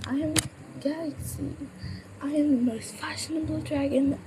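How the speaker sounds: a high, showy dragon who knows she's fabulous; high, proud fashionable dragon; Galaxy's voice. Acted. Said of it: "Pearl and Galaxy would be me".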